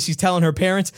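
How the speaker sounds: ordinary talk, nothing marked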